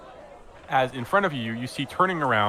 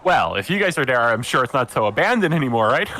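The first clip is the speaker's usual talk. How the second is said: forced cheer with an awkward laugh; awkward joking; 3 semitones higher, 5 dB louder than usual. Acted. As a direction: joke to lighten the mood, then laugh awkwardly. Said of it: "He looks a little awkward".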